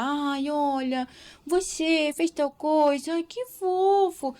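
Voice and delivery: put-on high, slow, sing-song baby talk; mock baby voice to a child. Shown as a demonstration. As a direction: coo it like talking to a newborn. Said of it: "não é a vozinha de neném que a gente tem que falar".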